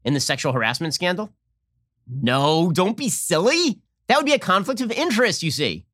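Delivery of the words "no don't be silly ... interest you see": high, singsong sarcastic put-on; sarcastically parroting a silly excuse; mock condescension. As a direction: scoff at it in a high, drawn-out mock voice.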